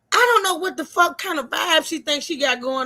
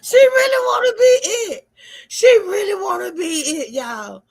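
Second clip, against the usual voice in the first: excited yelling; 6 semitones higher, 6 dB louder than usual. Real, not acted. Worked-up rant about dancer.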